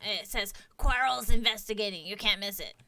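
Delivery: a hint of a squeaky kobold. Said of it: "I can't really do a different sounding kobold voice".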